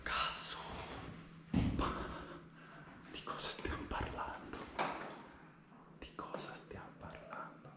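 hushed whisper, sneaking. Real, not acted. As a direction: whisper so nobody hears. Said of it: "scusate il volume".

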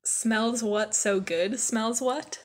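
usual speaking voice

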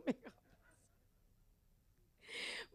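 words breaking into a laugh. Real, not acted. Preacher retelling thanks to the nurse, laughing.

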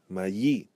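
"mayi" as ma-YEE, stress on the end; "mayi" with a stressed final yee; stress on final syllable. Shown as a demonstration. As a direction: lift the last syllable of "mayi". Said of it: "where we stress the final syllable".

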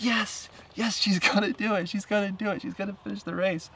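high, thrilled cheering; she's gonna do it, so excited. Retold or imitated. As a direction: squeal with joy as she speeds up. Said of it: "I'm super excited".

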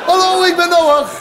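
high, drawn-out hail to a swimmer; calling out. Acted. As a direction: call across the water, stretched and high. Noah repeats his hail. (van ver roepend, hoog en gerekt)

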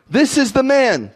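high, pointed accusation, acted out; shouting. Accuser pointing out Paul, acted shout. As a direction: call out the accused to the crowd. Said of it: "they shouted".

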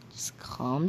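low unvoiced whisper, slow; soothing a ranting friend, whispered; whispered calm down. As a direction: hush them gently. Friend calming the ranter.

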